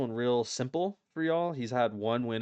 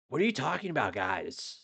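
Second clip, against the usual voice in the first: mock tough street voice, raised pitch; a badly faked Bobby Green accent; bad Bobby Green impression; 4 semitones higher than usual. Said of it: "I can't do a Bobby Green accent".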